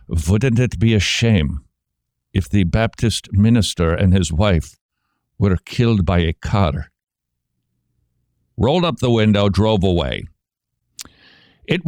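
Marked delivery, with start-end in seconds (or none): 0.1-6.9 put-on a light menacing Russian accent, vowels softened, w turned to v. Priest threatens the Baptist missionary, in a light Russian accent. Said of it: "they have a Russian accent".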